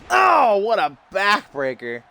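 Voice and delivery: sudden high, loud oh; excited shout. Real, not acted. Commentator reacting to a brutal backbreaker, high excited oh. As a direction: blurt it at the impact.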